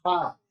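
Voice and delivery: "Ja" with long open friction on the J; no closure. Shown as a demonstration. Teacher proving the sound has no closure, audible friction. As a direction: keep the air escaping into "Ja". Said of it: "no hay un cierre".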